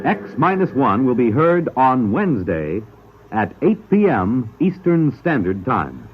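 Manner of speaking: ordinary talk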